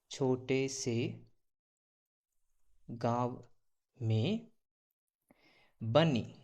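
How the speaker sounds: slow, with long pauses between words; dictation. Shown as a demonstration. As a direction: dictate word by word for writing. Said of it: "क्या लिख सकते हो इसको". (लिखवाने की तरह रुक-रुक कर)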